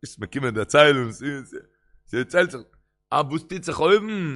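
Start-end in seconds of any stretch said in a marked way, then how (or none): 3.1-4.4 raised, louder acted question; insistent question. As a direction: press the question, higher and louder. Friend questions the returned dead.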